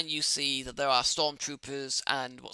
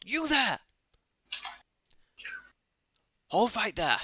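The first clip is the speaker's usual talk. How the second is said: higher barked order to halt; commanding call; 7 semitones higher than usual. Acted. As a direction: stop them with a call. Officer calls out to player.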